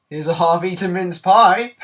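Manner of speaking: loud, high presentation; hammed-up enthusiasm; mock-excited reveal. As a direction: shout it like a grand prize. Presents a sorry consolation prize.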